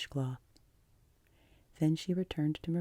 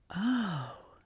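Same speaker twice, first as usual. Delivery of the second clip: higher, breath-filled "oh"; long-breathed sigh; 4 semitones higher than usual. A narrator's reading. Anne hears her guardian never imagines, sighing deeply. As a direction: sigh it out in dismay. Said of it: "drew a long breath".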